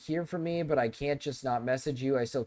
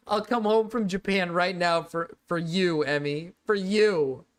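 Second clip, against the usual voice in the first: loud, high overacted plea; melodramatic begging; 5 semitones higher, 6 dB louder, slower than usual. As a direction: ham up the desperate promise. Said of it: "hammed second take".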